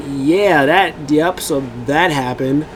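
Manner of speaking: ordinary talk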